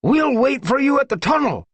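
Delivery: high, loud call back; shouted promise. Acted. One Whisker promising to wait, shouting back. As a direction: call the promise as you run. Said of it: "One Whisker promised".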